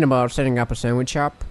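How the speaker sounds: lisping character voice. Acted. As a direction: speak with a light lisp. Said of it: "Now you have a lisp".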